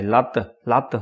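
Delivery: ordinary delivery, nothing marked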